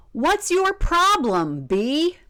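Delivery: high, slow mock yell; boy yelling. Boy yells at the silent bee, high and slow. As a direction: stretch it out high like a kid throwing a fit.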